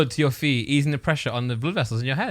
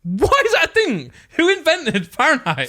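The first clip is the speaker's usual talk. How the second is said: much higher, incredulous rant; exasperated disbelief; 12 semitones higher, 3 dB louder than usual. Real, not acted. Mocking Fahrenheit to friends, higher exasperated disbelief. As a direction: demand an answer, baffled and indignant.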